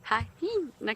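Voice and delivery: "Hyena" as high-hee-na, high and drawn out; extra H. Retold or imitated. Guide imitating South Africans, "Hyena" with an added H. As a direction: stretch "Hyena" slowly with a second, breathed H in the middle. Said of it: "put an additional H in that word".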